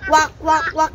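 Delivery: short, evenly spaced duck quacks; pretend duck. Shown as a demonstration. Parent asks child to pretend, demonstrating duck quacks. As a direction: quack three times like a duck. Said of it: "pretend that you're a quack".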